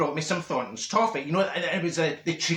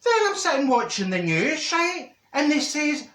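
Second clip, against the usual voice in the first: worked-up telling; 9 semitones higher than usual. Acted.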